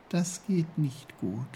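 high, softish hesitant flower voice; small voice, hesitating. A narrator's reading. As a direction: answer reluctantly as the little snowdrop. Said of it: "meinte das Schneeglöckchen zögernd".

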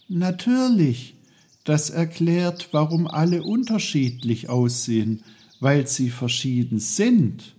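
put-on high, loud, excited boy; sudden realization. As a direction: exclaim as the answer dawns on you. Peter suddenly understands.

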